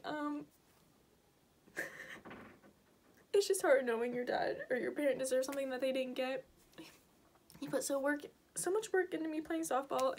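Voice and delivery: soft, tearful voice; crying. Real, not acted. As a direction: still crying while talking. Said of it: "I don't know why I'm crying".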